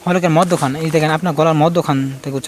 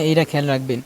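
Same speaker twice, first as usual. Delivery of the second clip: ordinary talk, nothing marked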